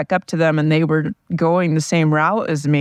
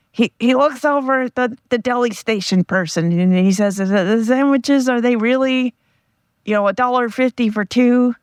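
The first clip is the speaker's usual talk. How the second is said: higher, mock friend voice telling a joke; imitating my friend; 7 semitones higher than usual. To her viewers, retelling a friend's joke in his mocked, higher voice. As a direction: put on a friend's joking voice, pitched up. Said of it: "I'm going to try to impersonate my friend telling the joke".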